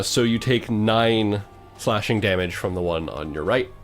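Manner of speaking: ordinary talk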